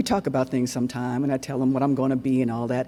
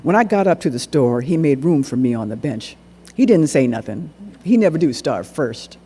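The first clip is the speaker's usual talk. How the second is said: ordinary delivery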